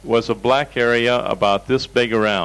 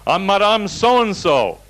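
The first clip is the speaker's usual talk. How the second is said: high, slow, haughty mock announcement; acted indignant patient; 9 semitones higher, 3 dB louder, slower than usual. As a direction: imitate an affronted woman proclaiming her name. Patient indignantly gives her name.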